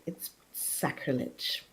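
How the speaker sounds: low, slow, whispered robot voice; flat machine voice, drawn out. Acted. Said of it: "a very robotic voice comes from it".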